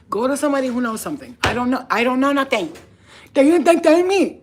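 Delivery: very loud, high, fast protest; frantic denial. Pretend. To the cops pushing her, a frantic high yell. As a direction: shriek that you know nothing.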